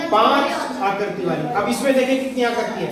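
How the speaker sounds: normal talking voice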